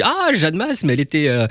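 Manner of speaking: high, gushing fan voice, lightly done; a light impression of a delighted Italian fan; excited Italian fan. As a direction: mimic a thrilled fan spotting a star. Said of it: "un Italien qui me dit".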